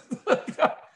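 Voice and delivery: high, breathless laugh; burst of laughter. Real, not acted. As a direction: laugh out loud at your own story. Laughs at his own story.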